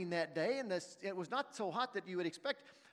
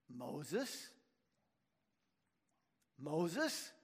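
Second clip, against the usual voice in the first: voice of the bush; 3 semitones lower than usual. Acted. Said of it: "And the bush said".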